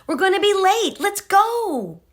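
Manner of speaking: high, loud impatient call; mother late and rushing; hurrying mother. Acted. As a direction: hurry the child along.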